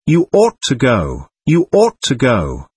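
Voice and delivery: "ought" stressed hard both times; insistent repeat, "ought" punched out; merciless emphasis on OUGHT. A narrator's reading. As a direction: drive home the word "ought" relentlessly. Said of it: "repeated with merciless emphasis".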